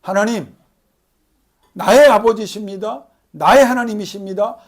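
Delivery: answering God's question in heartfelt faith; fervent confession. Pretend. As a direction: proclaim it with full conviction. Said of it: "이 질문 앞에".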